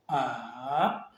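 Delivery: "A" dipping low, then climbing back up; long falling-then-rising "A"; third tone. Shown as a demonstration. Teacher shows the third tone, "A" dipping and rising. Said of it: "le troisième".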